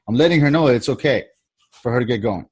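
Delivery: usual speaking voice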